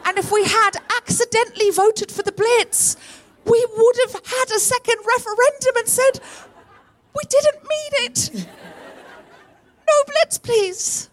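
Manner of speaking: very high, squealed comic plea; desperate mock protest. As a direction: plead at the very top of your voice. Acted plea to undo vote.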